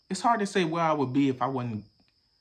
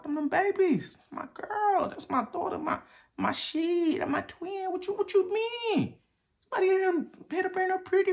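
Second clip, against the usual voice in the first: pitched up and choked over his daughter; emotional high; 13 semitones higher than usual. Real, not acted. Dad choking up about his bullied daughter, high and tearful. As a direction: voice climbing high, close to tears. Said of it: "voice rises high, near tears".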